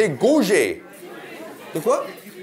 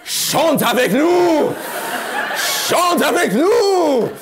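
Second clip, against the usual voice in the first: high, loud, slow call to sing along; hammed-up cult leader rallying the crowd; mock cult call; 9 semitones higher, 4 dB louder, slower than usual. Mock cult leader invites singing. (appel de gourou exagéré, aigu et fort)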